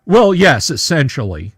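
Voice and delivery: a loud, high mock-pompous "well, yes"; imitating his reply. History buff answering a question, mock pompous. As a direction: play the smug expert giving a grand confirmation. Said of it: "he just kinda looks up and goes".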